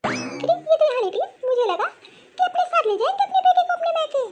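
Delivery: high-pitched, disgruntled mother-in-law; scheming mother-in-law. Acted. Mother-in-law plotting to be rid of her, disgruntled.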